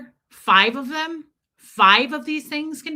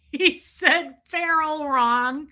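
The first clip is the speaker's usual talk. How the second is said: laughing; 5 semitones higher, much slower than usual. Real, not acted. Laughing while speaking.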